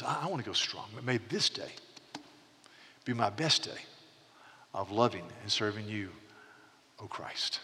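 softly imitating an old man praying, trailing to a whisper; quiet prayer. Retelling an old speaker's morning prayer, quiet. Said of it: "And he spoke very quietly".

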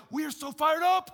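high, loud, jubilant cheer; fired-up shout. Retold or imitated. The party crowd cheering the son home, shouting. Everybody celebrating the son's return.

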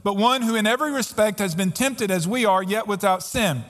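preacher reading Hebrews aloud; scripture reading. Acted. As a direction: proclaim the verses over the music. Reads scripture over music.

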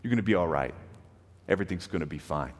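low, soft, gentle comfort; kind and reassuring. Retold or imitated. God comforting Paul in a vision, low and gentle. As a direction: soothe him like a frightened friend. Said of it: "the Lord speaks to him very kindly".

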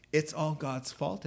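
ordinary talk, nothing marked

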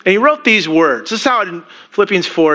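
normal talking voice

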